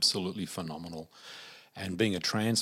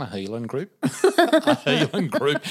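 laughing out loud, higher and louder; 8 semitones higher, 12 dB louder than usual. Real, not acted. Teller laughing at the healing group, higher and louder. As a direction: crack up as she says it.

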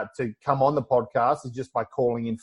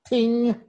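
imitated bell ding; 8 semitones higher than usual. Quiz host sound effect.

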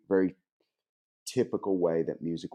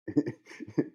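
a quick amused laugh up high; short high laugh; 6 semitones higher than usual. Real, not acted. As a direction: laugh lightly at yourself. Laughs at own joke.

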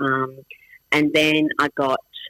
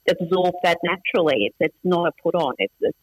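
ordinary talk, nothing marked

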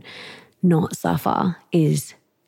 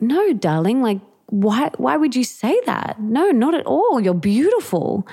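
higher, soothing mother's reassurance; reassuring mum; 7 semitones higher than usual. Retold or imitated. Mum reassuring her nine-year-old daughter, higher and soothing. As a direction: gently brush off the worry. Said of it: "her mum was like".

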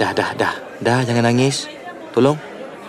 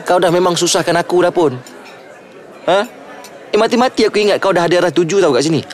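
frustrated; 7 semitones higher than usual. Acted. Aaron snaps in frustration.

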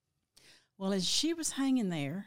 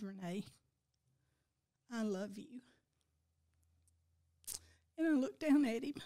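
gently, with real tears close; tearing up; 3 semitones higher than usual. Real, not acted. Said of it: "you know she's tearing up".

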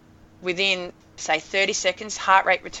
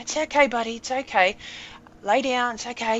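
soothing; 5 semitones higher than usual. Retold or imitated. Soothing injured dog.

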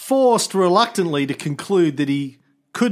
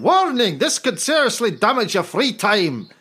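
put-on Irish accent pitched higher, playful; mock Irish accent; 4 semitones higher than usual. Host reads the review warning in a hammed-up Irish accent. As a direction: read it like a cheeky Irishman. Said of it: "do this in my best Irish accent".

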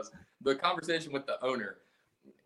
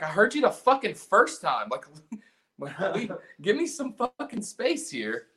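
irritated retort; 6 semitones higher than usual. Retold or imitated. Snapping back at the pushy lady.

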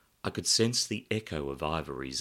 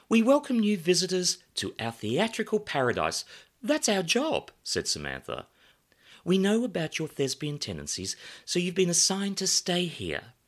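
high, bright welcoming hostess; glamorous hostess voice; 13 semitones higher, 4 dB louder than usual. Acted. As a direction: greet guests like a bombshell hostess. Samantha hostess voice.

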